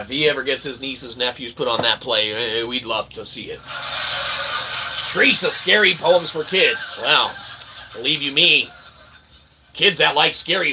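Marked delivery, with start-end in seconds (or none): none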